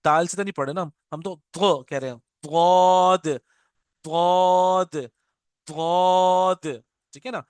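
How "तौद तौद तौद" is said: तौद stretched very long and thick; heavy drawn-out letter. Shown as a demonstration. Teacher drawing the letter out slowly for students, loud. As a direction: make it as heavy as you can. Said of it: "जितना मोटा कर सकते हैं मोटा कर लें".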